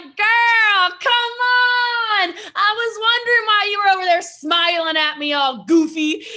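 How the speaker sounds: high, loud teasing near-shout; playful outcry. Retold or imitated. Jesse Lee teasing the grinning stenographer, loud high outcry. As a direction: call her out, laughing and loud.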